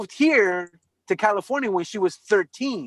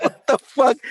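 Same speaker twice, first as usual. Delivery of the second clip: louder, higher mock disbelief; retold disbelief; 5 semitones higher, 5 dB louder than usual. Friend reacting to the baby's name, baffled. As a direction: burst out baffled.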